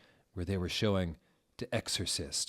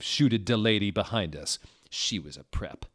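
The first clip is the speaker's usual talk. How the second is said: ordinary delivery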